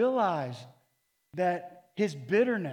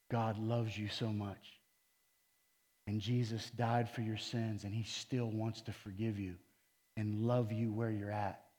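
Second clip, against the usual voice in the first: said it nice; 6 semitones lower, 6 dB quieter than usual. Retold or imitated. Telling an angry coworker that God loves him, gently. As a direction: ease the words out kindly, no edge. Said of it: "I said it nice".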